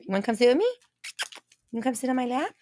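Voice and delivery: high, sing-song pet talk. Real, not acted. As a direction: coax the puppy up sweetly. Inviting the dog onto her lap.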